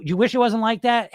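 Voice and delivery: ordinary talk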